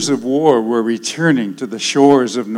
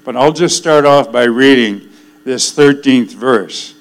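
normal talking voice

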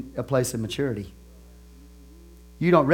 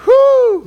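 high, loud "whoo"; big preacher's "whoo!"; loud whoop; 13 dB louder than usual. Real, not acted. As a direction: let out a long high whoop. Overwhelmed by the passage he preaches.

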